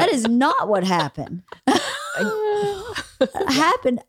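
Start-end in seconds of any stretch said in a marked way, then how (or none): 1.5-3.4 real high-pitched laughter. Real, not acted. Laughing at own story.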